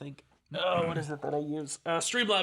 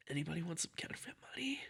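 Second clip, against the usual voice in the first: fast whispered shady offer; hushed, fast dealer pitch; 14 dB quieter, much faster than usual. Acted. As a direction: whisper it like a back-alley dealer.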